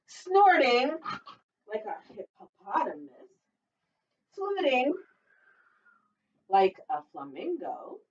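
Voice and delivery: put-on sing-song read-aloud for little kids; storytime reading. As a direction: read it like a lively picture book. Teacher reads picture book aloud.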